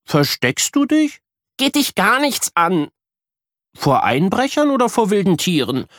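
little brother asking; curious little boy. Acted. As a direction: pester her with eager curiosity. Luca's curious questions.

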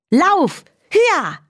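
hoch, jauchzend anfeuern; jubelnder Ruf. Acted. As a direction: wie auf galoppierendem Pferd rufen. Lilli reitet das Windpferd. (jauchzend anfeuernder Ruf)

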